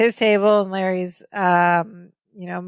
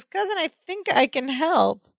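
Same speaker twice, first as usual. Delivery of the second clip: eager interruption; 8 semitones higher, 3 dB quieter than usual. Retold or imitated. Acting out Balki interrupting.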